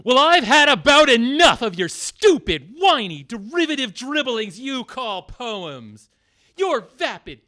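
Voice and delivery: loud, furious opening of a tirade; angry outburst. Acted. Actor fed up with the poet, furious. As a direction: let the anger burst out. Actor finally explodes at Poe.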